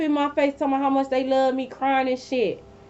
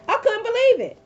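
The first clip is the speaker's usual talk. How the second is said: much higher, exclaiming in shock; disbelief; 12 semitones higher than usual. Real, not acted. Telling viewers about the ex's visit, high stunned disbelief. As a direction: throw it up high, still stunned.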